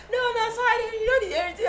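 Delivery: high, laughing imitation of the guy's apology; mock apology. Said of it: "And the guy was like".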